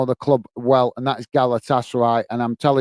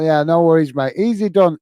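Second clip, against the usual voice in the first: usual speaking voice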